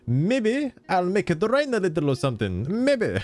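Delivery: cheery legendary voice. Acted. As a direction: play the big legendary, all sunny and relaxed. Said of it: "the legendary would talk like that".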